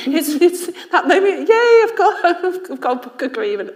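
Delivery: very high, loud, laughing glee; giddy laughing. Real, not acted. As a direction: squeal with delight at the deal. Giddy over a book deal.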